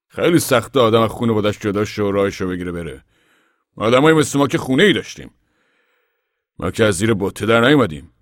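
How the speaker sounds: a proud father of the family speaking out; Papa voiced. Papa addressing the gathered men, loud and earnest.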